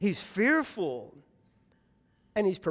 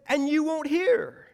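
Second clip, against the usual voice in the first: mild plaintive cry, pitched high; 6 semitones higher than usual. Acted. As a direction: cry it out as a complaint to God. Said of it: "he's crying".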